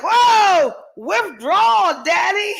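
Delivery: high, loud, drawn-out comic protest; mock alarm. Woman jokingly refusing her partner's withdrawal, high mock alarm. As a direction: swoop up high and stretch it, about to laugh. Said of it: "I feel like".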